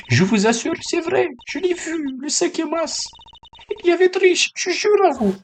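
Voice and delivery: very high, insistent put-on voice; high-pitched character voice. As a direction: swear it's true in a strained high register. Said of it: "la voix aiguë".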